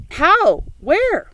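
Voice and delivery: high, loud, eager questions; excited question. A narrator's reading. Old grasshopper blurting out eager questions. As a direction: blurt them out in surprise.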